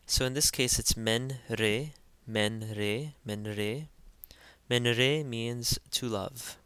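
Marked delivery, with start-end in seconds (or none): 1.0-3.9 "men re" as two separate syllables, men-reh; each syllable pronounced. Shown as a demonstration. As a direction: sound out "men re" slowly, then join it up. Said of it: "we pronounce each syllable".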